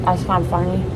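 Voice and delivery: talking through a mouthful of cookie; mouth full. Real, not acted. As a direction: mumble around a bite. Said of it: "Shouldn't talk with my mouth full".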